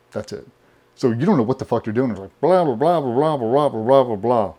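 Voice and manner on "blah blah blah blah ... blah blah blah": mock name-reader. Cadre reading off the draft list, mock droning gibberish. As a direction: rattle off names as mush. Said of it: "they read off a list of names".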